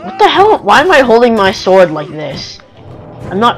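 ordinary delivery

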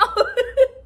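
a loud, high, rising burst of laughter near tears; high laugh-cry. Real, not acted. Manager offers to send her home, a high laugh-cry. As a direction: burst out laughing, close to crying.

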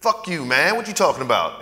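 loud, high-pitched imitated teen defiance; hostile retort. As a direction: snap back like a defiant kid, acted. Kid's hostile reply acted out.

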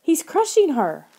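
high-pitched, panicky cry; alarmed scream. A narrator's reading. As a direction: cry out that someone is in danger. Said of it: "Lauren screamed".

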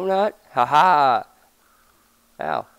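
ordinary talk

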